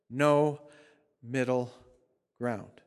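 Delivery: NO, MIDDLE, GROUND set apart; firm stops after NO and MIDDLE; pause between each word. Real, not acted. Preacher drives home his point.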